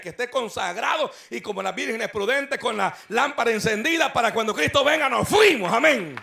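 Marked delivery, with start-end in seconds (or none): 1.4-6.2 loud fervent preaching; preaching shout. Real, not acted. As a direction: shout it out to the congregation. Preacher closing at full force.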